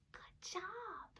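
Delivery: soft whispered good job; whispered praise. Acted. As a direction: breathe the praise softly.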